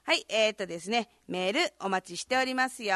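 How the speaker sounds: ordinary talk, nothing marked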